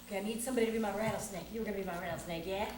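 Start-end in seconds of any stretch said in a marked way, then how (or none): none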